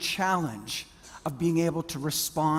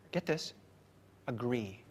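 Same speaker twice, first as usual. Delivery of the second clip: normal talking voice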